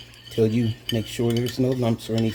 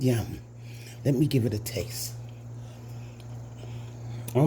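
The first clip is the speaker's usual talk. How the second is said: ordinary talk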